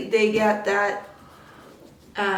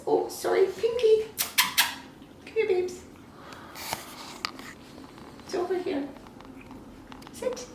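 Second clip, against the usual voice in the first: much higher sing-song puppy voice; puppy talk; 10 semitones higher, 6 dB quieter than usual. Real, not acted. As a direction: coo at the puppies, high and sweet. Cooing to puppy.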